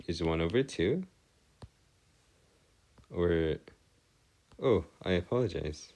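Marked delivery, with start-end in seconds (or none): none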